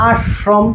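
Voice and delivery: আশ্রম with a thick, held "sh", "ash-shrom"; wrong heavy sh. Shown as a demonstration. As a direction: linger on a heavy palatal sh in আশ্রম. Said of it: "আমরা কি কখনো বলি".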